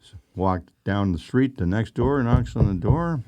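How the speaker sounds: usual speaking voice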